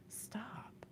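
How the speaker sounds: a sharp whisper; whispered stop. Real, not acted. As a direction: hush them with a single word. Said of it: "unvoiced stop".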